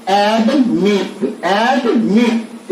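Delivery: Admit very slowly, split as एड, then मिट; syllable-by-syllable demo. Shown as a demonstration. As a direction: stretch admit into two separate beats, एड and मिट. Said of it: "आप उच्चारण पर ध्यान दीजिए".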